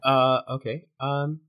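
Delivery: usual speaking voice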